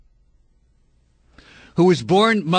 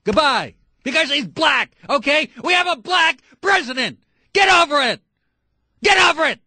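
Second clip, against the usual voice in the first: angry shouting; 8 semitones higher than usual. Real, not acted. Radio host to a birther caller, angry shouting. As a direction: shout it in outrage, stretching the key word.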